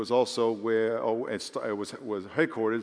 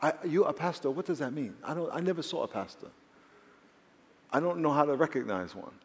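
higher-pitched mock English voice; imitated English accent; 4 semitones higher than usual. Young lady who never saw a pastor, imitated English accent. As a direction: imitate a baffled English woman. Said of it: "someone with an English accent".

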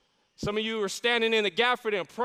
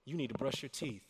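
much softer, half-whispered; hushed nudge; 8 semitones lower, 6 dB quieter than usual. Acted. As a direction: tease it like a quiet reminder. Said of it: "He's even going down to the details".

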